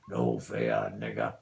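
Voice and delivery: dropped way down into a deep, hammed-up gangster voice; mock tough guy. Owner taunting the pet bird in a deep mock-tough voice. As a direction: growl it low like a movie hard man.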